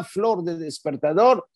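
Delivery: ordinary talk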